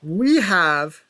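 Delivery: loud, slow announcement of the winner; title change call. Real, not acted. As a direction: announce the new champion with weight.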